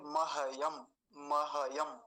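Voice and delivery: महयम as ma-ha-yam, three full syllables. Shown as a demonstration. Teacher showing the hypothetical full हकार, full h. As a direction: give the h in महयम its own vowel. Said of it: "अगर ये पूरा होता हकार तो कैसे बोलते".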